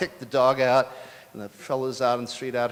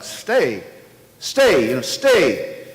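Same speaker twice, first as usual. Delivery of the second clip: loud commands, pitched higher; yelling stay; 7 semitones higher, 11 dB louder, much slower than usual. Retold or imitated. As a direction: call out firmly to a dog. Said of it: "Why are you yelling at the car to stay?".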